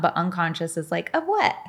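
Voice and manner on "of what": high-pitched mock confusion; baffled mind. Unconscious mind answering "just let go", baffled squeak. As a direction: squeak it as a puzzled question.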